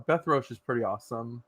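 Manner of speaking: ordinary talk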